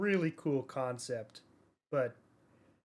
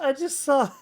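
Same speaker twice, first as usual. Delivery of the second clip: high, loud burst of laughter; laughing; 12 semitones higher, 11 dB louder than usual. Real, not acted. Co-host cracking up at the awful rating, laughing hard. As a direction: laugh out the words in disbelief.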